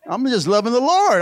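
ordinary delivery

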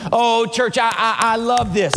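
ordinary delivery

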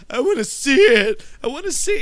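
high, loud, hammed-up excitement; mock eagerness. Film fan mimics heroine crawling towards the tornado, mock eagerness. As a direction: imitate a reckless, over-keen thrill seeker.

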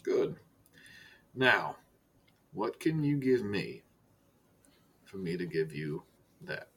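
low, soft, measured director voice with long pauses; Director Silas, understated menace. Acted. Director turns to business with Archibald, quiet and measured. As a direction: speak quietly and let each phrase hang.

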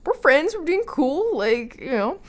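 high, loud startled whoa with a laugh; taken aback, half laughing it off. Retold or imitated. Shocked at husband's rude remark.

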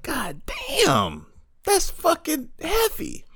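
high, slow awed exclamation; blown away. Retold or imitated. He reacts to Dizzy's quote, high and slow with awe. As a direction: pitch it way up, stunned and amazed. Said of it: "I was like".

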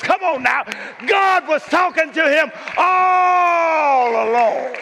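high, loud shouting preacher; full-force climactic sermon; climactic preaching shout. Acted. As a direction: bring the house down.

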